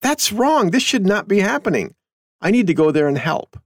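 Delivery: lightly voiced idealistic teen; imagined young man's thoughts. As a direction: play the righteous kid. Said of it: "I imagine he's thinking".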